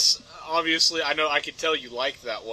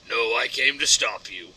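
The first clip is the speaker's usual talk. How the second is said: Batman; 6 semitones lower than usual. Acted. Batman answers the villain's taunt, low voice. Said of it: "And instead he says".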